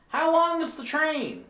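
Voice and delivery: high-pitched clueless tourist voice; dumb tourist. Acted. Tourist asking train worker about schedule, high clueless voice. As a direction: ask it high and naive. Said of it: "my dumb tourist".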